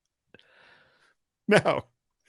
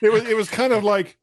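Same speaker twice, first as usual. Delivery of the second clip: ordinary delivery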